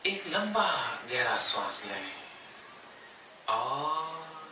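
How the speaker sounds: unhurried yoga nidra guidance with long pauses; guided meditation. Real, not acted. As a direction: guide them gently into stillness, phrase by phrase. Leads yoga nidra relaxation. (ठहर-ठहर कर योग निद्रा का निर्देश)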